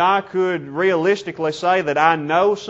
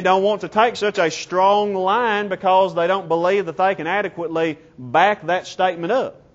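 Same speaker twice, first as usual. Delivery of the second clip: usual speaking voice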